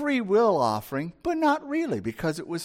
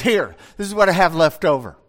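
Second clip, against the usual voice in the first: louder, fast, offhand "here, take it"; dismissive Cain; 6 dB louder, faster than usual. Acted. Cain handing God his leftovers, careless and louder. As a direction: toss it off like leftovers nobody wants. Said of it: "he goes".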